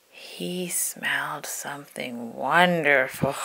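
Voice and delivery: slow whispered wonder; slow hushed storybook whisper. A narrator's reading. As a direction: whisper it like a secret delight. Narrator hushed at discovery.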